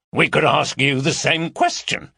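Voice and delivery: defiant comeback; retort. Acted. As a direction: turn it back on him. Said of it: "retorted Gary".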